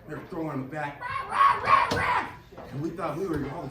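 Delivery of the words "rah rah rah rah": high roaring rah rah; mock yelling. Hogtied woman thrashing in the back, high loud mock yells. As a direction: roar it like she's thrashing.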